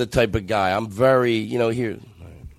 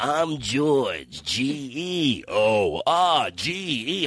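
higher put-on voice, slow; slow higher silly voice; 3 semitones higher, much slower than usual. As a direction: spell it out in a silly voice. Second intro, spelled slowly.

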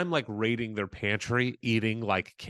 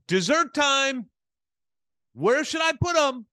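much higher, hammed-up cheerful voice of himself; mock chipper; 12 semitones higher, 5 dB louder than usual. To the grieving women at the table, a high mock cheerful offer. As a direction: sing it out like an oblivious party guest. Mimicking own cheerful arrival.

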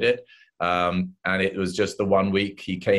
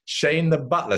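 usual speaking voice

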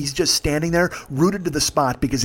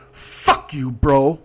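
retold drunken insult; 3 dB louder, much slower than usual. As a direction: spit out a mock angry insult. Retelling drunk passenger's insult.

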